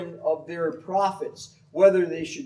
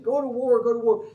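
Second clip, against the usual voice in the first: higher, louder urging; eager prophets; 8 semitones higher, 4 dB louder, faster than usual. Retold or imitated. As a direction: chant the war cry of the prophets.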